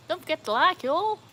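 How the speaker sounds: put-on American accent. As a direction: lift it into a perky American "y'all". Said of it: "Is that my good American accent there?".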